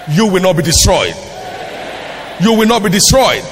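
loud, building pulpit declaration; forceful, punched preacher's promise; emphatic declaration. Real, not acted. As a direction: proclaim it over the crowd, stronger each time. Declares promise over congregation.

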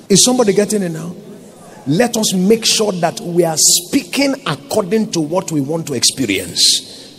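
ordinary delivery

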